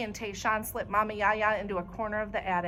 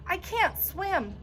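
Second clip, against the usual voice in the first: high, slow, scared plea; a boy terrified of the water; frightened cry; frightened wail; 10 semitones higher, slower than usual. A narrator's reading. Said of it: "he starts yelling".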